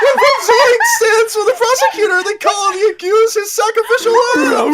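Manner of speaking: high, loud, laughing shout; laughing through the line. Real, not acted. Player reads the prosecutor line, breaking into loud laughter. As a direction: try to read it while cracking up. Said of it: "say the line again".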